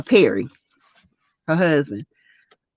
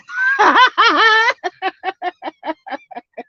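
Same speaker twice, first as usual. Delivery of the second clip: shrieking laugh; 12 semitones higher than usual. Real, not acted. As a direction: throw back a shrieking laugh. Laughs at worst husband vote.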